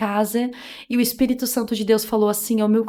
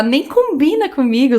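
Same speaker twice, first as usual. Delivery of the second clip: ordinary delivery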